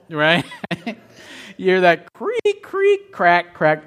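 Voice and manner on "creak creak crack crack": high creaks dropping to cracks; imitated cracking ice. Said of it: "cracking noise".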